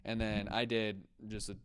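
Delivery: ordinary delivery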